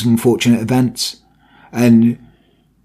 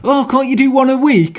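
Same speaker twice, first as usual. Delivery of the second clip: higher, mock pleading viewer voice; mock demanding fan; 8 semitones higher than usual. Mocking fans who want weekly videos, in a higher pleading voice. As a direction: whine it out like an impatient fan. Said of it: "People are like".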